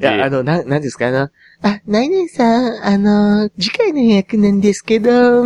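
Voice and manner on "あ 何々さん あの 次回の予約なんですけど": slow, high, dreamy receptionist voice; airy clinic receptionist. Retold or imitated. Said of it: "みたいな喋り方".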